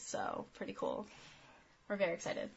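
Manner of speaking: flat, low, quiet monotone; deadpan monotone, no excitement. Real, not acted. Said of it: "as you can tell by my monotone voice".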